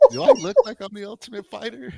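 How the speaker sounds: very high comic quoted voice; mock indignant boyfriend. Battered boyfriend repeating his retort, mock indignation.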